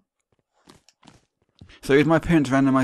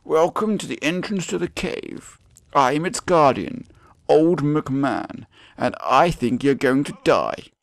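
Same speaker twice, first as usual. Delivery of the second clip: hammed-up guardian, slow and higher; old cave guardian; 4 semitones higher, 3 dB louder, slower than usual. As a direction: draw out a theatrical, ominous welcome. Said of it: "It's like".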